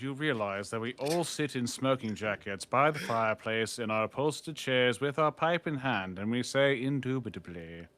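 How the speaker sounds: hammed-up Oxford British gent; put-on upper-class British, mock-stately; mock posh British accent. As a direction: play a pompous British don by the fireplace. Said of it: "I speak with the posh accent".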